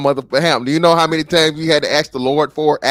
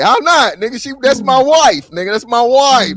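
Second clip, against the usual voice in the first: mock outrage; 6 semitones higher, 4 dB louder than usual. Mock outrage claiming wives.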